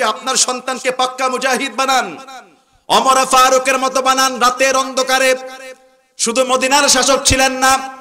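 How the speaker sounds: high-pitched rebuke from the pulpit; fiery preaching. Real, not acted. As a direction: preach it out to a big crowd. Preaches at full force.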